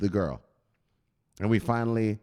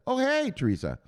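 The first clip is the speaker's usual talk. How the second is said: higher, playful fake-surprised hello; mock chance meeting; 11 semitones higher than usual. Suitor meeting Teresa again, higher mock-surprised greeting. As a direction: pretend it's a coincidence. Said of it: "So you'll see her there".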